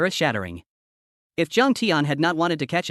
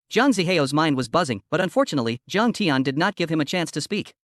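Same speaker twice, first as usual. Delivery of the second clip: ordinary delivery, nothing marked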